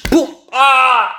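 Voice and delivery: sudden bang, then high loud cry of pain; acted bang and yelp. Storyteller relives hitting the thumb a second time, loud pained cry. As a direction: smack it, then howl.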